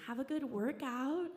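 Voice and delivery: put-on sing-song front desk kid; desk clerk. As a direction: parrot the bored teenager at the desk. Teen desk clerk greeting her.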